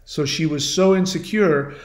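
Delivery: ordinary delivery, nothing marked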